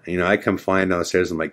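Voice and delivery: ordinary talk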